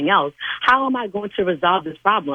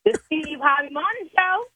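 host announce; 8 semitones higher than usual. Pretend. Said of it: "Tell me in your tone".

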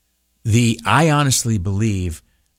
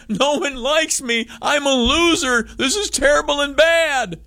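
much higher, whiny moping; mock self-pity; 14 semitones higher than usual. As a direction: whine like a sulking loser. Despair over no likes.

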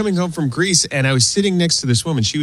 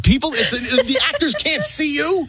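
high-pitched mock disbelief; retold incredulity; 10 semitones higher than usual. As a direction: call out the absurdity in a high voice. Retelling disbelief at cheering audience.

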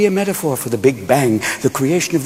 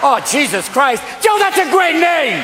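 very high, slowing excited cry; hammed-up outburst, pitched way up; 11 semitones higher than usual. Mary seizes on a name with Joe, high excited cry. Acted outburst in nativity bit.

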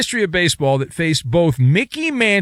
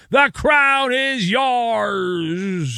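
slow, stretched announcer tagline; drawn-out slogan; 6 semitones higher, much slower than usual. Pretend. Host closing the DraftKings read, drawn-out slogan. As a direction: draw the final word way out.

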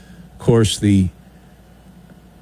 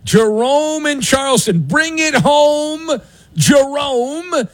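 high, drawn-out roll call; sing-out radio intro; show-opener announcer; 9 semitones higher than usual. Real, not acted. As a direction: introduce the crew with long held names.

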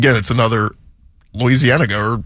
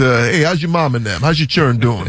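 lazy Cajun accent. Shown as a demonstration. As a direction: run it together in a lazy Cajun way. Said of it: "a little Cajun influence mixed with some laziness".

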